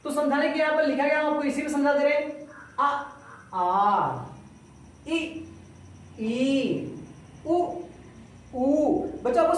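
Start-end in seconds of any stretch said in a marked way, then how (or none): 2.8-4.2 short a then drawn-out aa; short then long vowel. Shown as a demonstration. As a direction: clip the first, stretch the second. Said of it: "देखिए यहाँ पर". (पहले छोटा, फिर लंबा आ)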